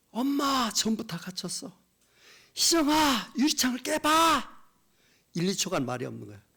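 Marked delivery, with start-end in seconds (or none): 0.1-1.7 high-pitched call out to mom; trapped daughter's desperate cry. Retold or imitated. 딸의 마지막 통화 재연. 2.5-4.6 slow, high, loud cry to her daughter; mother's desperate shout. Retold or imitated. 엄마가 갇힌 딸에게 외침.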